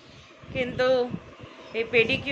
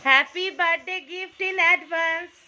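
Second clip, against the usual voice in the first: excited announcement; 6 semitones higher, 4 dB louder, much slower than usual. Real, not acted. Presenting surprise gift to mother.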